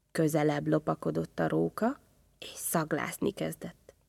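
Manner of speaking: usual speaking voice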